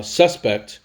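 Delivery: suspect with SUS hit firmly, pect lighter; stress on first syllable. Shown as a demonstration. Teacher modelling how to say suspect, first syllable stressed. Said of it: "stressed on the first syllable".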